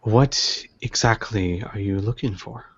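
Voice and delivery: lightly voiced, measured old dealer; a hint of a wily elderly host; shrewd old tea seller. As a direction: probe politely, in no hurry.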